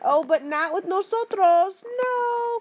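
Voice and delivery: high, drawn-out mock "no"; playful warning. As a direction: sing out the exception in a rising high voice. Mock-dramatic exception to the pattern.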